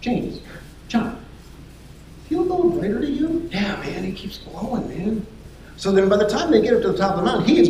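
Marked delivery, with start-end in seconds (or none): 0.0-3.5 high, squeaky hushed question; comic high-voiced bewilderment; furtive puzzled query. Peter whispers to companions. 3.5-5.2 soft, raised, conspiratorial agreement; quiet, higher-voiced awe; hushed amazed reply. Companion answers in hushed voice.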